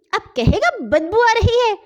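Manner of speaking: high, loud furious woman's threat; angry woman shouting high; angry scolding. Acted. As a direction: scold him while beating him. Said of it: "غصے کے مارے تن فن ہو گئیں".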